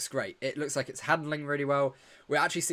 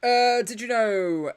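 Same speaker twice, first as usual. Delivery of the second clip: excited; 10 semitones higher, 9 dB louder, much slower than usual. Real, not acted. Sharing a fun fact eagerly.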